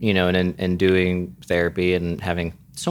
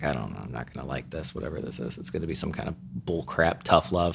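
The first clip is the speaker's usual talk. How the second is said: lower, much softer and fast, under the breath; dreading mutter; 5 semitones lower, 11 dB quieter, faster than usual. Retold or imitated. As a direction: mumble it quickly to yourself, bracing for bad news. Inner reaction to therapist.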